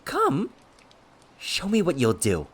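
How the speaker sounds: high, goading, manic dare; cocky provocation. A narrator's reading. Villain daring his cousin to strike, cocky manic goading. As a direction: egg him on, jumping loud on each command.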